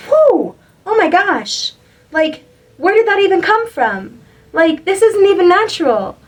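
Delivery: high, bright burst of amazed joy, whoo up top; amazed delight. Pretend. Reader imagining her own creation, squealing in amazed delight. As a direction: gush like you just surprised yourself.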